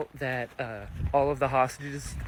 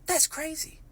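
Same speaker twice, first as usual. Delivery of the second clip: amazed; 13 semitones higher than usual. Real, not acted. Amazed reaction.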